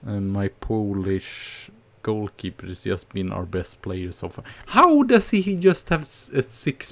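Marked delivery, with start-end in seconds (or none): 4.7-6.9 sudden high, loud disbelief; incredulous outburst. Real, not acted. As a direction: protest the rating in disbelief. Disbelief at player rating.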